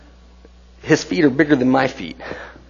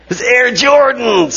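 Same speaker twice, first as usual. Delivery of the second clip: excited; 8 semitones higher, slower than usual. Real, not acted. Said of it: "excited about baby sneakers".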